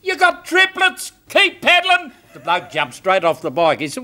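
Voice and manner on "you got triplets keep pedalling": very high, excited yell; frantic shout. Acted. Doctor delivering triplets by bike light, frantic high shout. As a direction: shout the news and urge him on.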